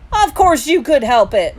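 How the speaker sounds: scolding. A narrator's reading. Mom rejects younger son's excuse, scolding. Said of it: "Mom scolded".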